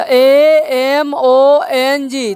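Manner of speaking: letters spelled out very slowly; slow spelling. Shown as a demonstration. Teacher spelling a word to pupils, very slowly. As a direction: spell each letter for the class. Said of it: "यहाँ देखो ए से start हुआ है".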